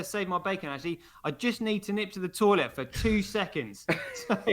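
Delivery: ordinary talk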